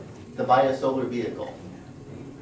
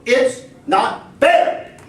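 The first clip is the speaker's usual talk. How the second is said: presidential speech; 4 semitones higher, 4 dB louder than usual. Retold or imitated.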